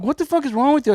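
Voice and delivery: high-pitched acted outrage; indignant retort. Acted quarrel with trucker.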